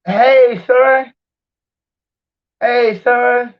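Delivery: high, delighted greeting; calling out to son. Real, not acted. Calls to son who just joined.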